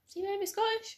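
high-pitched, puzzled query, retold; puzzled realisation. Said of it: "the two of us were like".

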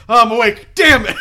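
high, loud mock dismay on waking; waking sleep contestant. Host acting out a sleeping contest's loser, loud comic dismay. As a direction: blurt it like a contestant who just lost. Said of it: "someone wakes up and goes".

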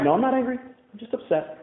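a quick denial that sinks to near silence; defensive denial. Retold or imitated. Husband denying anger to his wife, defensive and hushed. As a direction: insist you're fine while shrinking away. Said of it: "a classic thing that people in denial say".

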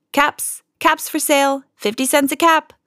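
high, sing-out peddler's cry; street vendor's call. A narrator's reading. Peddler to townsfolk on the street, calling out his caps. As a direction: call your wares out, high and sustained.

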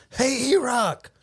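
imitated high, drawn-out hail; calling out. Man hails golfer across parking lot, mock high call. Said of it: "your boy goes".

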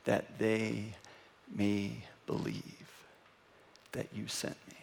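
slow, low and very soft, with pauses; hushed prayer. Retold or imitated. Preacher closing Jesus' prayer to the Father, hushed. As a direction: let each phrase fade towards a whisper.

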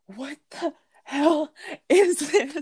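high, slow, drawn-out disbelief; appalled disbelief. Retold or imitated. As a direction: stretch each word in appalled horror. Retelling horror at strange stuffing.

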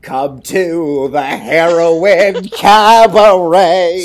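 slow, showy emcee invitation; cabaret announcer. Pretend. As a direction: croon it like a cabaret host.

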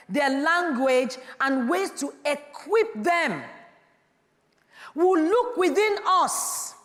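loud, high, ringing pulpit voice; full-force preaching. Acted. Pastor rousing the congregation, preaching at full force. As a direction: preach it out to the whole hall.

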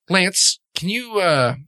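high, polite summons; calling the waiter. Acted. Host calling Lance to take the order, lightly summoning.

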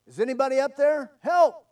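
high, loud, desperate call; yelling for help. Acted. Man on a cliff calls to passers-by, yelling for help. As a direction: call up to anyone passing above. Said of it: "he began to yell for help".

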